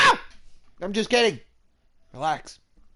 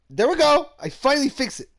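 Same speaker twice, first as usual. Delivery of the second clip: high, loud victory cry; triumphant shout; 19 dB louder than usual. Real, not acted. Player celebrating fixing the game, high loud cheer.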